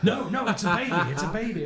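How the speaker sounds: mocking insistence. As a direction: insist through a laugh, poking fun.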